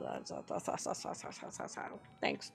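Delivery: breathy whispered stutter; faint whispered stutter; whispered stammer. Acted. Whispered stammer before thanking Dad.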